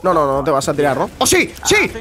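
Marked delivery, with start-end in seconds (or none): 1.2-1.9 high, sudden cheer; excited reaction. Real, not acted. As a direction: cheer the play as it happens. Reacting to a kill. (grito de emoción repentino)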